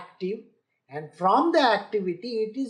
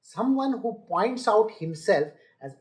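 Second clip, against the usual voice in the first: ordinary delivery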